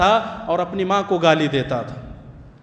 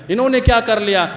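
louder, higher dismissive scoff; mock scornful question; 5 semitones higher, 4 dB louder than usual. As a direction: imitate an arrogant youth sneering. Voicing youths' dismissive thought, louder. (طنزیہ انداز میں)

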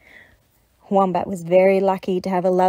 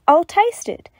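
eager agreement; 10 semitones higher, 6 dB louder than usual. Acted. Dingo agrees.

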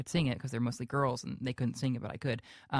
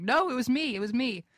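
retold protest, higher and louder; insistent protest; 9 semitones higher, 4 dB louder than usual. Singer answering fans who doubted him, insistent protest. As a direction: insist on it, pitched up in mock indignation. Retelling his protest to disbelievers.

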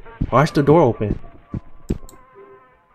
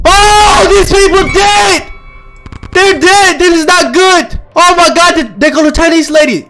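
high, loud panicked outcry; horrified shouting; 10 semitones higher, 5 dB louder than usual. Real, not acted. Gamer finds the restaurant staff dead, high horrified shouting. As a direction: wail the discovery loudly, way up high. Discovers dead bodies in game.

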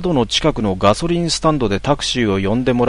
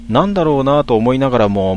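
usual speaking voice

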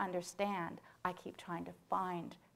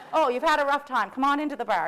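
welcoming host; 6 semitones higher, 13 dB louder, faster than usual. Retold or imitated. Airport staff welcome stranded passengers.

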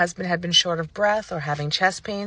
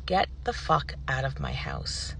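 low, slow, quiet command; measured, dead-serious dismissal; firm order; 4 semitones lower, 6 dB quieter, slower than usual. Retold or imitated. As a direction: order them out with controlled force. Ordering the EMT out, retold.

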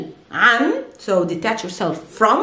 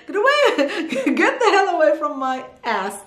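laughing; 6 semitones higher than usual. Real, not acted. Laughing translation.

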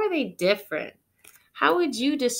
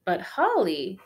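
usual speaking voice

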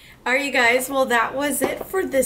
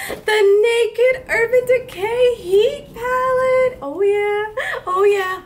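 excited, high and loud; 9 semitones higher, 6 dB louder, slower than usual. Real, not acted. Reveals the Heat palette.

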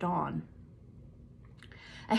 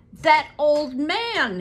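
slow, loud and high outburst; a Gestapo chief shouting across the room; cry out; 8 semitones higher, 8 dB louder, much slower than usual. A narrator's reading. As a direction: bellow "That old man!" in disbelief. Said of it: "he cried".

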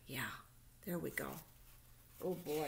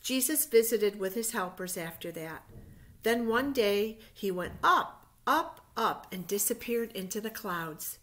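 reading a Bible story aloud, louder and measured; story narration; 7 dB louder than usual. A narrator's reading. Teacher reading Easter story to children, storybook narration. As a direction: tell it like a picture-book story to little ones.

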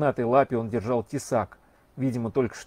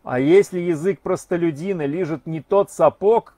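louder, pointed lecturing; loud declaiming; 4 semitones higher, 6 dB louder than usual. Acted. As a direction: proclaim it loudly so the street hears. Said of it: "громко говорил он".

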